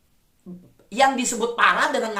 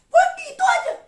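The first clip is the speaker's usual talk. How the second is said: mock exclamation; 4 dB louder than usual. Real, not acted. Preacher mocks the reaction.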